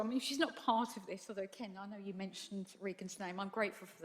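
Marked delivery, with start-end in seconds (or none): none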